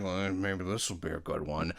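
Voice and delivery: bored mutter. Retold or imitated. Bored listener's inner thought.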